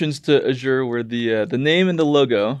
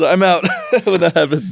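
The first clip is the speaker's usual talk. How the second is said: laughing through the words; chuckling as you say it; 4 semitones higher than usual. Real, not acted. Laughing over the flying sign.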